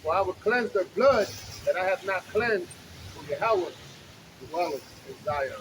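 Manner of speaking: booming street-preacher reading; scripture reading. Acted. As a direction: proclaim the verses to the crowd. Reads scripture aloud on street.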